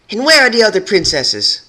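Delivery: very high, loud demand; demanding shout. Acted. Rebel demanding where the princesses are, loud.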